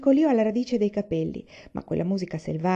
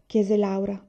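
ordinary delivery, nothing marked

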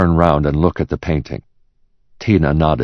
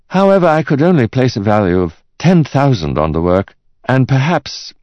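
higher-pitched appraiser's voice; cautious art expert; 9 semitones higher, 3 dB louder than usual. Acted. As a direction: value it carefully, like an expert. Expert values the painting.

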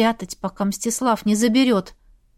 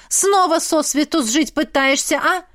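higher-pitched scolding; heated village woman's reproach; indignant; 6 semitones higher than usual. A narrator's reading. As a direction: tell her off in outrage. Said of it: "возмутилась пряха Ильна".

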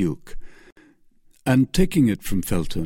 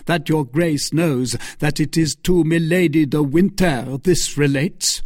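a hint of rough sharpness, higher; sharper, rougher voice; 6 semitones higher, 4 dB louder than usual. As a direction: let the edge creep into the voice. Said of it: "in a voice that became more sharp and rough".

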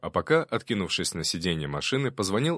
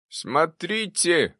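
high, long-drawn proclamation; ghost's grand announcement; proclaiming; 9 semitones higher, much slower than usual. A narrator's reading. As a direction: announce it high, stretching the word out. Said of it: "провозгласил сверху призрак".

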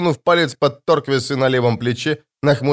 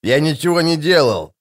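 mildly raised, aggrieved protest; indignant; 3 semitones higher than usual. A narrator's reading. Shtyr denying blame, indignant. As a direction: protest your innocence. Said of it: "возмутился Штырь".

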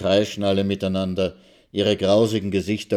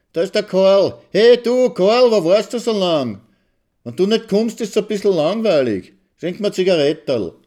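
much higher, loud eager greeting; a gushing, flirty hail to Karl; excited calling out; 12 semitones higher, 8 dB louder than usual. A narrator's reading. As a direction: call out to him across the square, delighted. Mitzi hails Karl across square.

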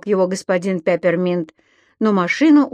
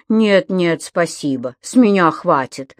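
plain reading voice

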